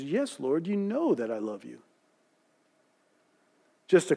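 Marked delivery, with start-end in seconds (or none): 0.0-1.8 a quieter, lower Peter; subdued confession. A narrator's reading. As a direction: lower and softer, a hushed, ashamed answer. Peter's ashamed second answer.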